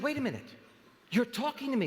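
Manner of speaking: a light startled double take; astonished. Acted. Astonished man in a joke.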